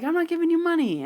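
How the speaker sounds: high, quick protest; insistent mock refusal. Man at bar refusing to pay fifty bucks, high mock protest. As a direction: imitate him refusing in a high pitch.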